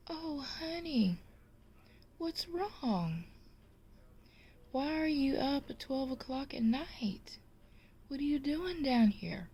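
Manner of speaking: high-pitched, sweet worried wife; put-on high woman's voice; concerned wife. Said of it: "the woman says".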